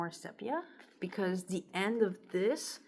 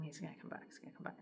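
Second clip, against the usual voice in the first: whispered, much softer, repeating it; hopeful whisper; 2 semitones lower, 16 dB quieter, much faster than usual. Retold or imitated. Heartbroken girl whispering to herself that he'll return. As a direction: whisper it like a quiet hope to yourself. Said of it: "it was like".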